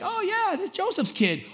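very high, offhand crowd voice; acting out a scoffing townsperson; mock dismissive. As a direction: brush him off in a high pitch. Acted crowd dismissing Jesus.

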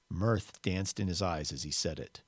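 ordinary delivery, nothing marked

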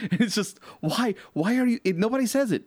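high, exasperated rising complaint; why would anyone write it that way. Real, not acted. Frustrated colleague ranting about clumsy writing, high-pitched disbelief.

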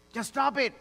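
high and punchy; firm command. Acted. Pastor voicing Paul to the church: high, firm order. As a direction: snap it out. Paul's command after whisper.

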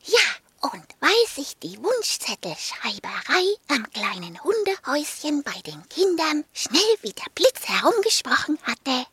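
plain reading voice

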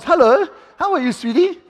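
high, sweet cooing voice; mock-tender hello to a chair; sweet greeting. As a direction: coo at it like a pet. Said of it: "sweet greeting to the chair".

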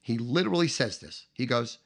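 ordinary talk

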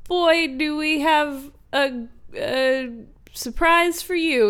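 high-pitched, drawn-out showman's build-up; shelter staff patter. Acted. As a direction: tease a big reveal, slowly. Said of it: "people at the Humane Society are like".

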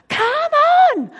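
high, loud cry of come on; shouting encouragement. Retold or imitated. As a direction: yell to spur your child on.

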